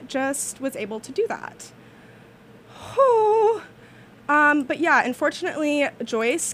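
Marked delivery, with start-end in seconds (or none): 2.9-3.6 a high, loud blown-out whew of relief; loud relieved whew. Real, not acted. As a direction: blow out the whew like you just pulled it off. Relief after finding it.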